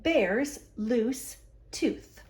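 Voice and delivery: usual speaking voice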